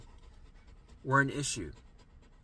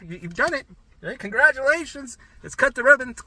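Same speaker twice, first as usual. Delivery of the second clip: mock celebration; 10 semitones higher, 7 dB louder than usual. Mock celebrating the viewer's recovery.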